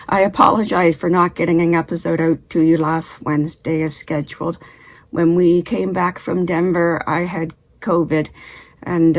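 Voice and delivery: ordinary talk, nothing marked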